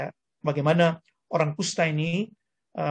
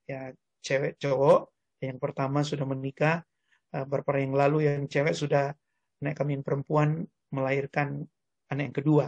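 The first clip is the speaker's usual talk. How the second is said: ordinary delivery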